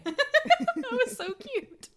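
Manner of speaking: squealing with laughter; high giggly delight; laughing. Real, not acted. Laughing at cohost raising hand.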